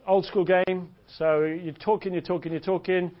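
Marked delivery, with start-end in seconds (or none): none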